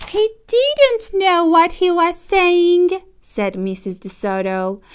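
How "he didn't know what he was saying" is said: much higher, gentle mouse voice; wife defending the fox to her husband, squeaky mouse voice; small mouse wife. Acted. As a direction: pipe it up like a tiny mouse lady. Mrs DeSoto's voice.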